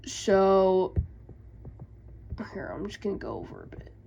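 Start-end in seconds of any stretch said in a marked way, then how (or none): 2.5-3.9 whispering. Real, not acted. Aside before recap section.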